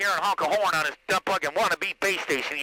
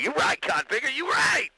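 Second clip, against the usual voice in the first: excited shout; 9 semitones higher than usual. Real, not acted. Hyped agreement on the radio.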